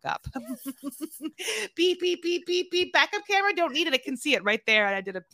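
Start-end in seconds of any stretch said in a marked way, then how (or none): 1.7-3.9 high mock "beep beep" of a reversing car; imitating reversing beeps. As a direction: play a backup camera beeping. Imitating backup camera beeps.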